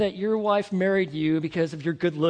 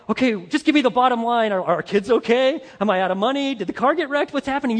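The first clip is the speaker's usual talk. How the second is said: mock impatient husband; 4 semitones higher, 7 dB louder, faster than usual. Husband impatient in wife's talk.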